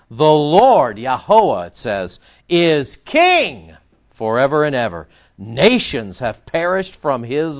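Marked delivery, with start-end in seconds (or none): none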